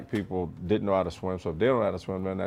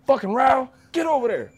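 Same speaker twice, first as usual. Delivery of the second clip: much higher, strained drill sergeant bark; drill instructor yelling; 4 dB louder than usual. Retold or imitated. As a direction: yell at the recruit like a furious DI. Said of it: "'cause they go".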